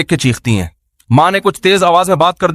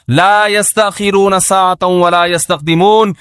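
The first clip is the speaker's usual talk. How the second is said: Quranic recitation; slower than usual. Acted. Quranic verse recited in sermon.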